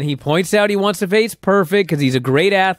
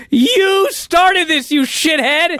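high, loud mock outrage; drawn-out opening, incredulous yell; exasperated outburst; 14 semitones higher, 4 dB louder, much slower than usual. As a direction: scold the instigator in hammed-up indignation. Host mock-scolds the interviewer.

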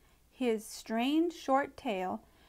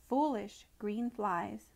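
ordinary delivery, nothing marked

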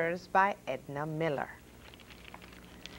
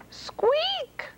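very high, tiny squeak; mouse squeak. A narrator's reading. Mouse popping out of the cabbage, very high squeak.

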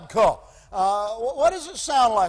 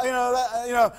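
ordinary delivery

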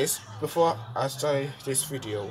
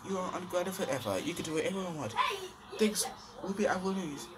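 higher, softer comforting voice; higher and softer, tender; high soft girl voice; 8 semitones higher, 4 dB quieter than usual. Acted. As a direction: soothe Max as a gentle big sister. Ruby comforts Max.

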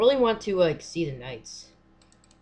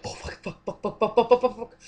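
panicked swearing; 4 semitones higher, 3 dB louder than usual. Real, not acted. Panicking after jump scare.

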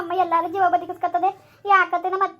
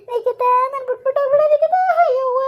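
desperate wail; 6 semitones higher, 4 dB louder than usual. Acted.

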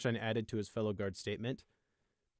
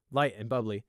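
ordinary delivery

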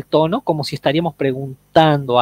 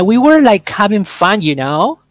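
mock valley-girl uptalk, higher and louder; breezy rising uptalk accent; 5 semitones higher, 4 dB louder than usual. Shown as a demonstration. Teacher mocks the uptalk accent for students. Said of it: "Ese acento".

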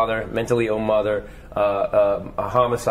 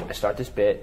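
usual speaking voice

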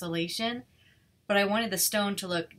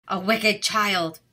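slow, louder outraged witch voice; witch crying out; 7 dB louder, much slower than usual. A narrator's reading. Witch scolds Rapunzel, slow and outraged. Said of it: "cried the witch".

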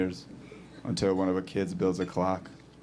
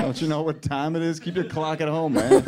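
higher-pitched exasperated rant; mock scolding; 7 semitones higher, 7 dB louder than usual. Comic telling a kid to keep his clock at home, mock outrage.

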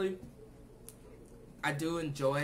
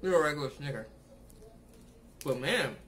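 chewing while talking; talking between bites; mouth full; 3 dB louder than usual. Real, not acted. Seen 1: bit bar at -12; closed, working mouth during speech.

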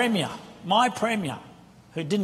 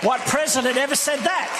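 higher, louder shout; preaching shout; 7 semitones higher, 4 dB louder than usual. Real, not acted. Preacher exclaiming to the congregation, loud. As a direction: rouse the congregation. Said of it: "preaching climax".

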